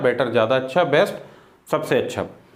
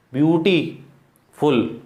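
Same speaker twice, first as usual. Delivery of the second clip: Beautiful very slow, long pause before फुल; split into syllables; much slower than usual. Shown as a demonstration. Teacher showing more than one syllable, word broken up. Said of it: "यहाँ syllable एक से ज़्यादा है".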